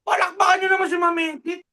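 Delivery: high, loud, fast shout for applause; rally the crowd to clap; calling out to the audience. Real, not acted. Host urging viewers to applaud Ma'am Edith, loud and fast.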